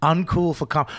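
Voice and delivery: usual speaking voice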